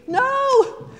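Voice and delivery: high, loud mock outburst at the screen; imitated yell. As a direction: yell it at the movie screen, half joking. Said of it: "not to jump up and yell at the screen".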